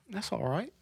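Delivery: high, soft mock British accent; playful British accent, rising at the end. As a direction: put on a British lilt, lightly and high. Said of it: "I love their accents".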